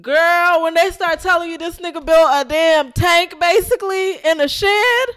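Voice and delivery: loud, high near-shout; thrilled gossip at full volume; excited. Real, not acted. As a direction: shout it in disbelief. Peak excitement telling the story.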